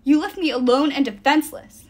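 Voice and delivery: upset, forceful accusation; hurt accusation. A narrator's reading. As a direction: hurl the blame at him. Nico accuses Will, eyes filling.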